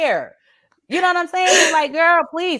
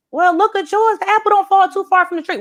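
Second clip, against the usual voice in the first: sassy comeback; 9 semitones higher than usual. Retold or imitated. Retold comeback, high.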